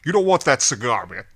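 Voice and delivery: put-on pushy Brooklyn accent, punched out; thick Brooklyn accent. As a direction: imitate a store owner's thick Brooklyn accent. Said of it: "try to do his thick Brooklyn accent".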